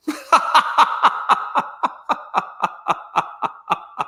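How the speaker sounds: high, wheezy cracking up; real drawn-out laughing fit; long laugh. Real, not acted. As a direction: laugh helplessly at your own story. Cracking up at friend's reply.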